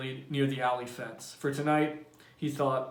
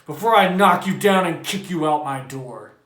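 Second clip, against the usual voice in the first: loud threat; threatening yell; 2 semitones higher, 10 dB louder than usual. A narrator's reading. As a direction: bark the threat. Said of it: "Montag yelled".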